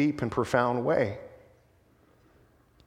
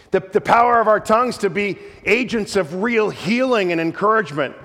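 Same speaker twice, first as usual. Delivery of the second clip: louder, higher, driving the point home; preaching at full force; 6 semitones higher, 5 dB louder than usual. Acted. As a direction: preach it, rising with conviction. Preacher builds on the tongue's power.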